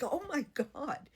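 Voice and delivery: drawn-out, high, slow exclamation; stunned gasp. Retold or imitated. Patient reacting to the surgeon, a slow high stunned cry. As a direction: breathe it out in stunned disbelief.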